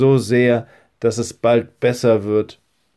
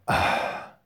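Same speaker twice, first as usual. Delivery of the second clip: ordinary delivery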